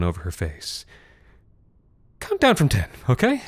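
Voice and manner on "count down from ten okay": high, strained reassurance; terrified forced cheer. Acted. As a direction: fake calm over terror. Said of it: "terrified, forced quality".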